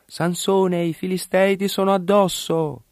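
high-pitched calling cry; grido d'allarme. A narrator's reading. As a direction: shout a warning on a high pitch. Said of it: "Allora essa gli gridò". (grido acuto di avvertimento)

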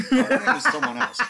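lachend gesprochen; laughing. Real, not acted. Laughing mid-sentence about casting.

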